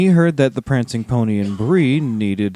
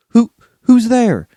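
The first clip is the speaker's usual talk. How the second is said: higher, louder scared call; frightened call; 10 semitones higher, 6 dB louder than usual. A narrator's reading. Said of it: "Sam called out".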